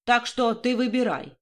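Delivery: ordinary delivery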